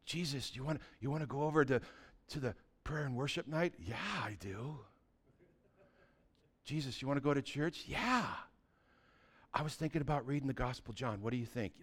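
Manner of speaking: softly asking Jesus, almost private; hushed inner dialogue. Acted. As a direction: keep it low and intimate, like talking in your heart. Acted talk with Jesus, hushed.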